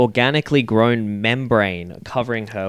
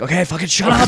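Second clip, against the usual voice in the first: mock fed-up snap, a little higher; exasperated outburst; 5 semitones higher, faster than usual. Trapped woman telling the AI to shut up, exasperated. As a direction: act out losing patience with a nagging machine.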